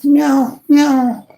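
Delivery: a pleading kitten calling for attention, very high; cat voice. Pretend. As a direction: meow up high like a needy kitten. Imitating the meowing cat.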